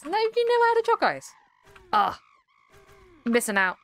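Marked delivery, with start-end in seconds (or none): 0.0-1.3 much higher, stunned "never"; can't believe it; surprised disbelief. Real, not acted. Disbelieving streamer tells chat they have never had choc ice.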